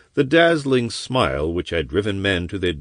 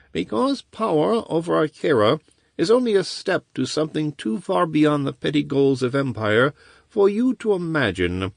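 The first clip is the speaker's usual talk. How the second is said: bleak; 9 semitones higher than usual. Acted. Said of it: "said Shorzan bleakly".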